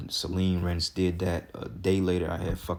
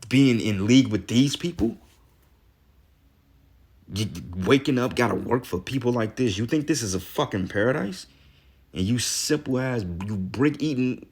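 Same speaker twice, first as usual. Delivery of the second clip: heated, louder and higher rant; real rising anger, loud and raised; getting pissed off; 5 semitones higher, 4 dB louder than usual. Real, not acted. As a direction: let the anger climb as you preach. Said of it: "Now I'm getting pissed off".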